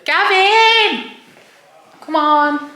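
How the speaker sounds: shouted name across the house; calling out. Real, not acted. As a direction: call him loudly from afar. Calling friend from another room.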